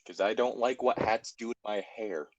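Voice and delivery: usual speaking voice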